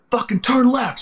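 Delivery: high-pitched barked order, retold; imitated angry commander; cussing out. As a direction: bark it down at the driver. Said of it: "He's cussing down at me".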